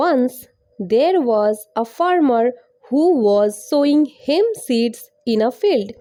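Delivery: very slow, separated English words; slow teaching pace. Shown as a demonstration. Teacher reading story line to learners, very slowly. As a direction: model each word slowly for copying. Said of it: "অনেক আস্তে আস্তে".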